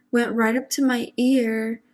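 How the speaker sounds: ordinary talk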